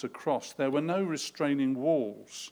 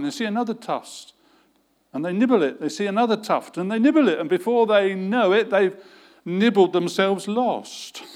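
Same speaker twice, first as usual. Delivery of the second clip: building; 6 semitones higher, 9 dB louder than usual. Real, not acted. Preacher building on sheep.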